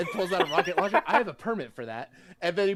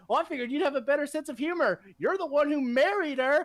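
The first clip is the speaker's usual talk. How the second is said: cheeky comeback; 12 semitones higher, faster than usual. Retold or imitated. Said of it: "He's like".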